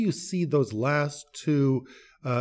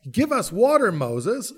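mock demand, raised high and loud; demanding people; 5 semitones higher, 5 dB louder, slower than usual. People of Israel demanding water from Moses, loud mock demand. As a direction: bark it out like an impatient crowd. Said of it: "and they're saying".

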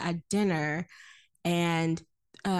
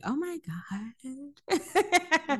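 drawn-out, higher mock dismay; appalled disbelief; 5 semitones higher, much slower than usual. Reacting to Winston's democracy boast, appalled disbelief. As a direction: stretch it slowly in mock horror.

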